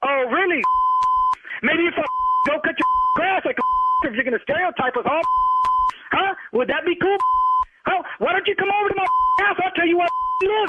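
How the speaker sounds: angry sarcastic challenge. Real, not acted. Insulted man dares the prank caller to come over, angry. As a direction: throw down an indignant challenge.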